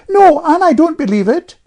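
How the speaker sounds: put-on high, loud stubborn child; Jane contradicting. A narrator's reading. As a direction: retort flat-out as a defiant girl. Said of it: "returned Jane unexpectedly".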